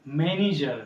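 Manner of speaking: ordinary talk, nothing marked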